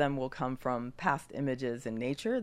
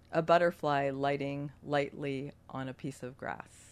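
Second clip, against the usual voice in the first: guided meditation. Acted. Leads a meditation.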